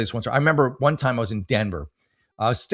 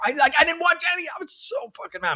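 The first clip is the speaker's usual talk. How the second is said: high-pitched outrage. Real, not acted. Furious recall of hotel clerk.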